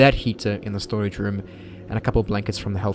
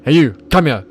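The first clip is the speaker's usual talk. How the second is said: louder barked instructor yelling at a student; stern instructor shout; 2 semitones higher, 7 dB louder, faster than usual. Retold or imitated. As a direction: yell like a scary drill instructor, stumbling. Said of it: "perfect imitation".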